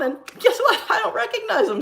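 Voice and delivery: high-pitched, laughing delivery; amused, gleeful. Real, not acted. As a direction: say it through a giggle, way up high. Gleeful aside about avoiding a parent.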